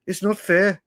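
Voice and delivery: a weak, put-on Birmingham accent; a quick, half-hearted Brummie impression of the pundit; poor Brummie accent. Mocking the pundit with a poor Brummie accent. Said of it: "That was a really poor Brummie accent".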